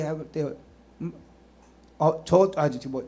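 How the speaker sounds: ordinary delivery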